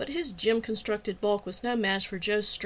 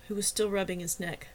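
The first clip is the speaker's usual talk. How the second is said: usual speaking voice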